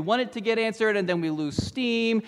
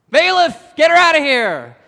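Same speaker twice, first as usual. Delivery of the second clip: much higher, louder barked order to the bailiff; judge's yell; 8 semitones higher, 12 dB louder than usual. Retold or imitated. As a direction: shout the bailiff over, fed up.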